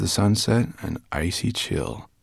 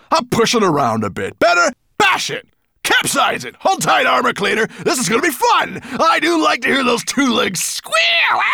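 high, loud, mounting villain excitement; gloat, building to a shout; wicked glee; 6 dB louder than usual. A narrator's reading. Dragon relishing an attack on a boat, gleeful.

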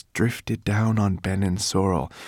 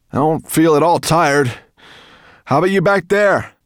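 loud, raised-pitch call; calling back; 11 semitones higher, 9 dB louder than usual. A narrator's reading. Firedrake asks after his riders, calling back. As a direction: call over your shoulder to passengers. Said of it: "he called back".

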